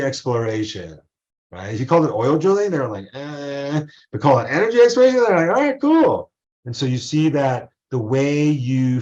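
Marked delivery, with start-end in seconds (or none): 3.1-3.8 mock lukewarm "eh"; unimpressed. Lecturer voicing Americans cool on oil drilling, unimpressed. 5.6-6.3 high, loud mock approval; enthusiastic approval. Lecturer voicing Americans sold on energy exploration, enthusiastic.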